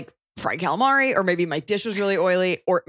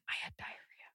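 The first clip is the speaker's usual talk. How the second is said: confiding whisper; hushed confession to listeners; 20 dB quieter, faster than usual. Real, not acted. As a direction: breathe it like a secret. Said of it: "Can I confide something?".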